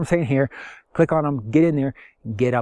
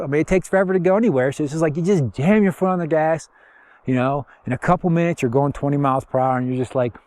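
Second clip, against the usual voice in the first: ordinary talk, nothing marked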